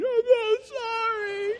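put-on high, slow, blubbering child voice; mock crying child. Weeping child apologizing to dad, high and drawn out. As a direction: whimper it like a crying toddler. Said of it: "already crying".

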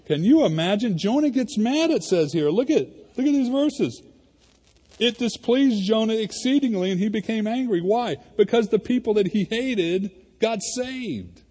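high, loud, rising sermon passion; preaching at full force. Acted. Pastor to his church, fiery full-force preaching. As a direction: preach it with fire to the congregation.

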